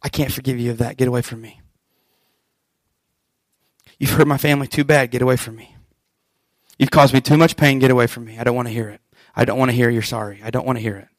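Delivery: a quiet, bitter get away from me; quiet cold refusal. Acted. Unforgiving person shutting out the one who hurt them, softly.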